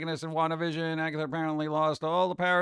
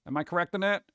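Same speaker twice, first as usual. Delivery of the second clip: ordinary talk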